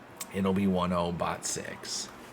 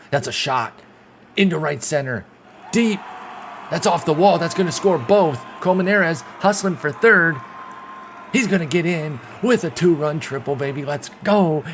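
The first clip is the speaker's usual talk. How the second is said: higher, louder, rising home-run style call; excited play-by-play; 7 semitones higher, 6 dB louder than usual. Real, not acted. Fan calling his team's two-run triple, excited shout. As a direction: ride the hit all the way to third.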